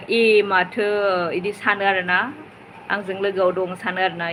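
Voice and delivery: normal talking voice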